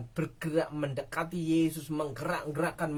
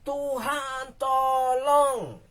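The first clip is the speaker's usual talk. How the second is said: higher, louder anguished call; desperate plea; cry for help; 8 semitones higher, 6 dB louder, much slower than usual. Acted. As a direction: shout to God at the end of hope.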